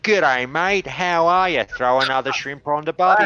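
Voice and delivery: loud, high, exaggerated Aussie accent; hammed-up Australian. Mocking Australian actors in films, hammed-up Australian accent. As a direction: lay the Australian accent on thick, like a film caricature. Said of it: "ham it up, like, an extra twenty percent".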